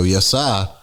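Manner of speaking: "sir" in "Yes, sir" loosened to a falling "sah"; slung-out "Yes, sir". Shown as a demonstration. Showing co-host the loose version, "Yes, sir" as "yes-sah". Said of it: "when you kinda slang it out like that".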